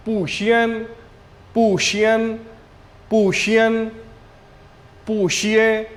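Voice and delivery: पूशिए and पूशियन repeated slowly and clearly; pronunciation drill. Shown as a demonstration. Teacher drilling पूशियन for students, repeated clearly. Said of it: "आप देख रहे हैं पूशियन".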